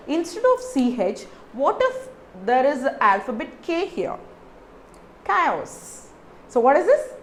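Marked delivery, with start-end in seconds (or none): 5.2-6.1 Chaos said with a hard K, kai-os; K sound. Shown as a demonstration. Teacher models Chaos with a K sound for learners, careful pronunciation. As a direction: start Chaos with a K. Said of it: "It is called K".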